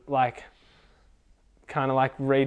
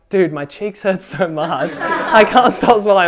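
laughing; 5 semitones higher, 7 dB louder, slower than usual. Retold or imitated. Friend laughing while talking.

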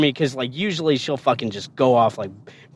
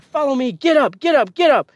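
high, urgent repeated commands; drill sergeant barking orders; 9 semitones higher than usual. Retold or imitated. Drill sergeant ordering recruit off the ground, barked commands. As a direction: hurry the recruit up with sharp orders. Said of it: "because she yelled at me so fucking much".

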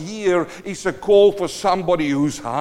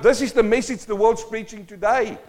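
raised, insistent sermon voice with loud peaks; preacher pushing the church on its hunger for God; fiery preaching; 6 semitones higher, 5 dB louder than usual. Acted. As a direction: hammer every question home to the congregation. Preacher at full force.

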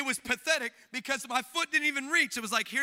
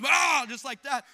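normal talking voice

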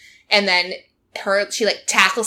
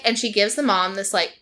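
usual speaking voice

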